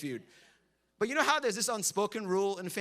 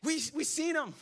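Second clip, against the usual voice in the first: excited women; 6 semitones higher than usual. Acted. Women excitedly telling disciples.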